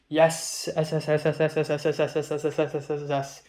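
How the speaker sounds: "yes" with the S rattled off over and over; repeated final S. Shown as a demonstration. Imitating over-enthusiastic texting, endlessly repeated "S". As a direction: keep repeating the S of "yes" in an even string. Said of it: "lots of E's at the end, lots of words at the end".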